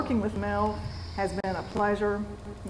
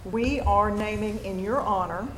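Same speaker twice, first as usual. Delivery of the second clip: normal talking voice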